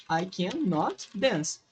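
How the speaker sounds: very slow, each word apart; slow teaching read. Shown as a demonstration. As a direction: dictate it word by word. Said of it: "colocar esse aqui na negativa".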